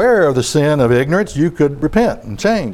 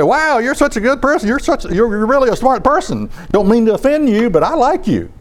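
gushing, higher-pitched fake praise; butter him up with sugary compliments; mock flattery; 8 semitones higher than usual. Preacher mimics a flatterer lying to a foolish person, gushing falsely. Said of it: "and you say".

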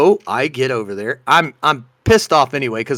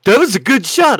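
much higher, louder, delighted; amused praise; 9 semitones higher, 6 dB louder than usual. Retold or imitated. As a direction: cheer the throw through laughter. Florida man cracking up.

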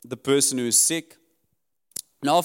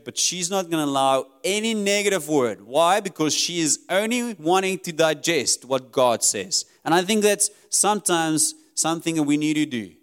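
usual speaking voice

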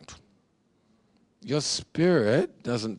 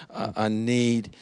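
normal talking voice

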